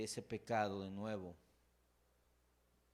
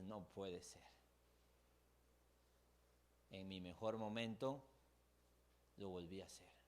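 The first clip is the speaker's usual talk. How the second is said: quiet despair; 4 semitones lower, 7 dB quieter than usual. Retold or imitated. Voiced quiet despair after relapse.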